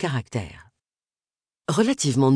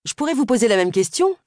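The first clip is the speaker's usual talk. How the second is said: curt retort; 7 semitones higher, 3 dB louder than usual. Acted. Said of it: "lui retourna Agatha aussi sec".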